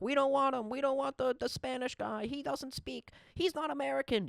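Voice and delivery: high mocking voice of an angry fan; lightly voiced mock MAGA complaint. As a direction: sneer it up high like a caricatured critic. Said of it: "all the maga people were like".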